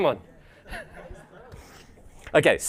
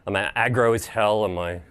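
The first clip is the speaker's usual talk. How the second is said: ordinary talk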